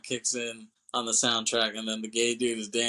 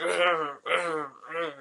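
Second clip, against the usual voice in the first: repeated growls, pitched up; imitated fighting growl; 5 semitones higher, much slower than usual. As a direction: growl out each bash as it lands. Imitating a face-bashing beatdown.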